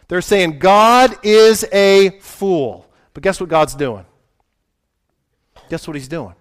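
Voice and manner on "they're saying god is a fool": slow emphasis. Real, not acted.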